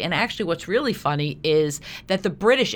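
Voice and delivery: normal talking voice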